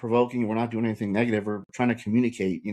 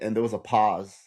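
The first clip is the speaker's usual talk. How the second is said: ordinary talk, nothing marked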